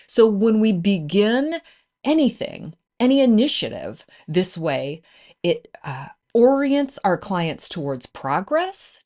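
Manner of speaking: usual speaking voice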